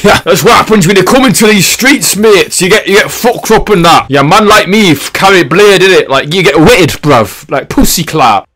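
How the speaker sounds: hammy British hard-man slang; British street accent. Acted. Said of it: "doing a British accent".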